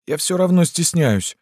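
put-on high-pitched girlish admission; bashful girl. A narrator's reading. Lyuska to Yegor while undressing, bashful. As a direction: confess it in a high voice.

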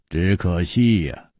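low, slow, mild regret; sighing regret. A narrator's reading. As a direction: let it out slowly like a sigh. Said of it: "楚留香叹了一口气说". (低沉叹惜)

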